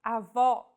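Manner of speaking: Avó with stress on an open final O, a-VÓ; Portuguese avó. Shown as a demonstration. Teacher says grandmother word, open stressed final O. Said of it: "This word means grandmother".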